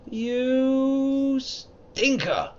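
very high, stretched-out cry; drawn-out mock insult. Real, not acted. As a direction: drag it out in playful outrage. Cursing the game's strength requirement.

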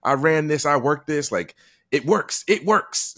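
high, loud, excited boast; loud mock showman voice; imitating Tyler Perry. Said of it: "he's like".

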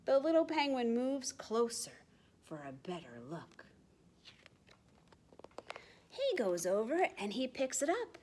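whispered, creeping narration; hushed suspense. A narrator's reading. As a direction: lean in and whisper. Curious creeping closer.

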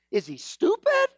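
high-pitched disbelieving question; mock incredulity, pitch leaping up; incredulous God. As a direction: ask it as if dumbfounded. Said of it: "And God's going".

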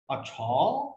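lightly imitated film line, "अच्छा" rising at the end; "अच्छा" drawn into a questioning lift; a hint of a movie-character catchphrase; Aamir Khan. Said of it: "याद आया आपको आमिर खान का".

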